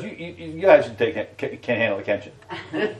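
dry, mocking disbelief; sarcastic. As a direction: say it with a sarcastic sneer. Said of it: "I read it sarcastically".

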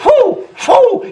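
rising playful whooshing hoots; ghostly whoo. Retold or imitated. Preacher mimics the demon swinging on the chandelier, rising hoots.

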